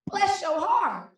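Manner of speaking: a mock Southern drawl on bless your heart; Southern mama. Mama down south, quoted with a slow drawl. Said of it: "so down south, she said".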